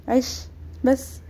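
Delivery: عش بس said very slowly; drawn out careful عش; slow correction. Shown as a demonstration. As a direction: spell out عش slowly. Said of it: "انا كنت اقول عائشه بس يعني هي اش".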